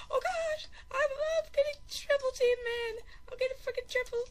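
high-pitched gamer panic; frantic high complaint; panicked. Real, not acted. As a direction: squeal it, cornered. Ganged up on in game.

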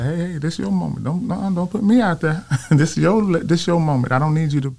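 high-pitched, modest mock protest; retold protest. He tells his mentor it's her moment, protesting.

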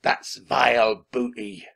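slow, loud pirate growl; drawn-out villain scorn; disgusted pirate captain. A narrator's reading. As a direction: spit it out with contempt.

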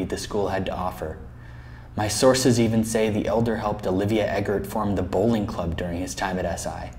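low, hushed storyteller; legend storytelling. Acted. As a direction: tell it like a campus legend, soft and low. Recounts the legend of the elder.

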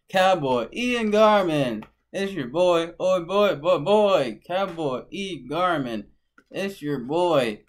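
loud, punchy announcer-style self-introduction; hype intro. Acted. Cowboy introducing himself to his viewers, loud hype intro. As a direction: belt out a repeated catchphrase with high energy.

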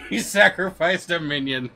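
high and loud, bursting into laughter; laughing. Real, not acted. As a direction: blurt it out as you crack up. Cracking up at his own story.

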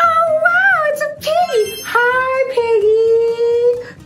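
put-on high, excited kid-show voice; reading to a toddler. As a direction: greet the piggy like a toddler host. Children's show host greeting.

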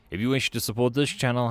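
ordinary delivery, nothing marked

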